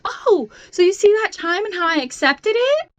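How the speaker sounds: high, falsely cheery explanation; mock bright high voice. Driver faking cheer to the passenger, high and bright. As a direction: sound chipper to hide the fear. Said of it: "I was like".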